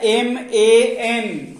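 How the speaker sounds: letters spelled out very slowly; teacher slowly spelling the word for the class. Shown as a demonstration. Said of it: "क्या लिखा हुआ है?".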